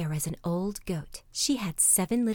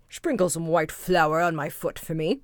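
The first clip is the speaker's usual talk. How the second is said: low wolf voice, making a demand; sly wolf; 3 semitones lower, 3 dB louder than usual. Acted. Wolf orders the miller.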